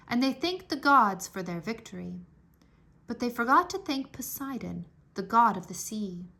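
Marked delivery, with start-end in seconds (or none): none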